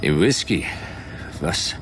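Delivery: groggy confusion, words coming in broken pieces; drugged and fading. Acted. Drugged man questions the woman about the whisky, woozy and faltering.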